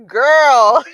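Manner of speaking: loud high mock "Girl" at herself; mock scolding girl. Scolding her past self on video, loud. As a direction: call her own younger self out.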